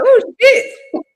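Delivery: high, loud mock-panicked yelp; mock shock. Cohost acting out shock at a gas bill, mock shock. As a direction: yelp it like the bill just landed.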